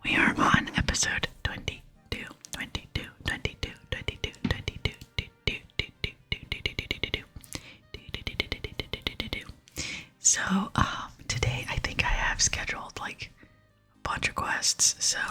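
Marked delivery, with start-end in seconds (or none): 1.4-9.8 crisp repeated whispered syllables; whispered trigger repetition. Shown as a demonstration. Repeating the episode number for ASMR listeners, rhythmic whisper. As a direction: whisper it over and over like a rhythmic tick.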